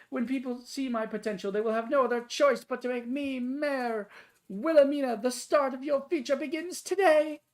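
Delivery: lofty ladylike character voice; prim high-society lady. Acted. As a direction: play a vain, swooning spinster. Long in-character monologue.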